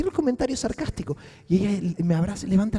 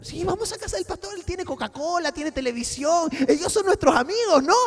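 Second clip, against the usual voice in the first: put-on higher, sing-song talk to a little kid; childlike voice; 8 semitones higher than usual. Coaxing the girl to come home, higher childlike voice. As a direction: coax her like a small child. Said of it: "como una niña pequeña".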